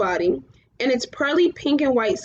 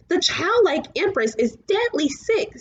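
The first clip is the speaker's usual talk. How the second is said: dragon voice; 6 semitones higher than usual. A narrator's reading. Falkor voiced by narrator.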